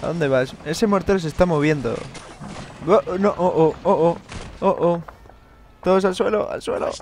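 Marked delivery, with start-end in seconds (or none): 2.9-5.0 loud, high, alarmed cries; panicked oh no. Real, not acted. Mortar shell incoming. 5.8-6.9 high urgent take-cover order; shouting orders over battle. Pretend.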